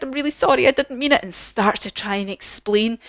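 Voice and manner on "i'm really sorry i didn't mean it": put-on higher girlish apology; higher-pitched, pleading storybook girl; Dorothy. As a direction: apologise in a light, high little voice. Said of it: "And Dorothy said".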